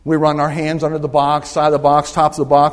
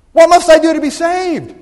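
loud, high, urgent cry of a question; greater emphasis; 12 semitones higher, 9 dB louder than usual. Shown as a demonstration. Preacher asks the congregation the question with emphasis, shouted. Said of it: "put a little more emphasis on this time".

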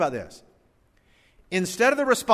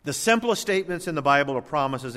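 ordinary talk, nothing marked

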